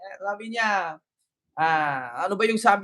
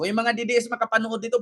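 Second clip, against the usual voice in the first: normal talking voice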